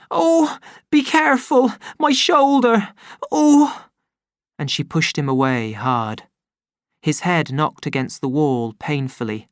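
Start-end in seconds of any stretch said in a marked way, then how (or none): 0.1-3.9 high, sharp pained outcry; cry of pain. Acted. Linda cries out as her son hugs her sore shoulder. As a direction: yelp as he touches the bruise. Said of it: "Linda cried out".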